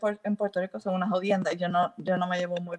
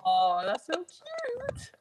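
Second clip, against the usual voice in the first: enternecida; 7 semitones higher, much slower than usual. Real, not acted. Enternecida por el futuro nieto.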